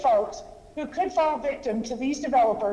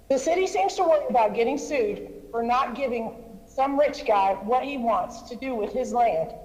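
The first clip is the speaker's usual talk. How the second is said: ordinary talk, nothing marked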